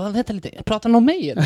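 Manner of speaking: high, puzzled question; puzzled roommate. Retold or imitated. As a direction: act out the guy recognizing himself. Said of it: "Han bara".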